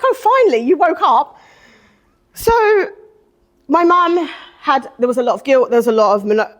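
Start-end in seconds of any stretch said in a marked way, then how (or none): 0.0-1.4 higher and louder, exasperated finally; sarcastic at last, pitched up; sarcastic relief. Retold or imitated. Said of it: "I was like".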